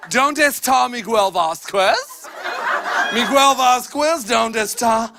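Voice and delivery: put-on slow, flat American accent on Spanish; gringo teacher Spanish. Teacher calling roll with clumsy American Spanish. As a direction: Miguel Vasquez pronounced with an English w and s. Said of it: "This Señora McIntyre argued with a Mexican".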